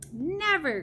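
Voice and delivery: a high, loud heartfelt "Never!"; cried out passionately in protest; passionate cry. A narrator's reading. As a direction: fling the denial out with feeling. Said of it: "cried the B F G passionately".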